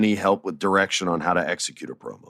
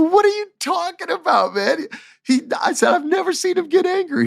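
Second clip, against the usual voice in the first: shocked. Retold or imitated. Retelling his shocked reply.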